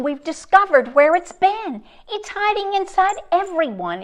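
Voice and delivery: ordinary delivery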